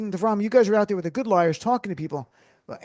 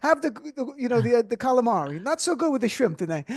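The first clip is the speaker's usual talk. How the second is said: high, hammed-up Italian waiter accent; 5 semitones higher than usual. Shown as a demonstration. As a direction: sing it out like an Italian waiter apologising for the shrimp. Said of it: "with an Italian accent".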